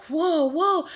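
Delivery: high rising startled whoa; replaying a jolt of fear; alarmed whoa. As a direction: gasp it out, caught off guard. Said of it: "And I was like".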